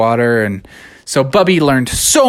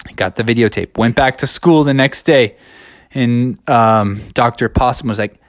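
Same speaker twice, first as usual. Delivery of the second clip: ordinary delivery, nothing marked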